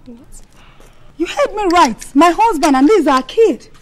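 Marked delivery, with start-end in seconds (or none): 1.2-2.3 high, loud defiant shout. Acted. Second woman claiming the man in front of his wife, defiant. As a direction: stake your claim at full voice.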